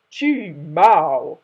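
loud deep boom. Shown as a demonstration. Father lion silencing his cub, loud deep boom. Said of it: "Maybe be like".

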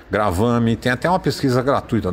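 normal talking voice

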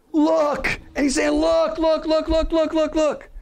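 high, loud repeated calls; excited shouting. Acted. Preacher voicing God calling to his people. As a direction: call out urgently over and over. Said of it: "a loud voice".

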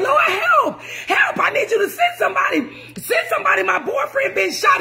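high, panicked cries for help; frantic screaming plea. Retold or imitated. Caller begging dispatch for help, hysterical and high. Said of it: "She started screaming and said".

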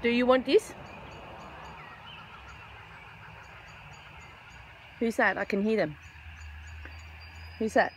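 high, sing-song voice coaxing a bird; pet talk. Acted. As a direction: coax the little bird over, sweet and high. Offering food to birds.

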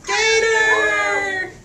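high, loud, panicked shriek of the name; frog screams. Acted. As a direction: scream it out in fright. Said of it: "the frog screams".

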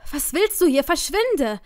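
high, loud panicked outburst; panisches Hervorstoßen. A narrator's reading. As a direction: force it out in fright, pitch jumping up. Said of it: "brachte ich so gerade noch hervor". (panisch und laut hervorgestoßen)

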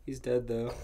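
plain reading voice

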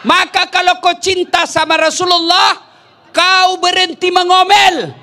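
high, loud preaching push; full-force preaching. Acted. Preacher exhorting wives to stop nagging, high and loud. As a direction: drive the point home to the women.

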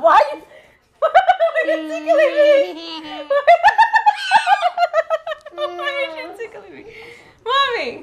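high giggling protest; laughing while tickled. Real, not acted. Being tickled.

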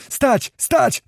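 very high, short yells; yelling. A narrator's reading. Other voices order the men to stand, yelling high. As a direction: yell a sharp command to stand. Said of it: "wrzeszczały inne głosy".

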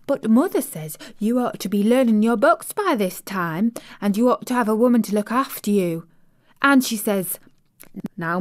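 lightly voiced Yorkshire housemaid, chatty and pleased; broad Yorkshire vowels, friendly and eager; Yorkshire maid. Maid telling the little girl about home, light Yorkshire accent. Martha's Yorkshire dialect speech.

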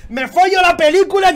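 real fan outburst; ecstatic shout. Real, not acted. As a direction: yell it in pure hype. Hype reaction to manga reveal.

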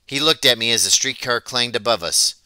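plain reading voice

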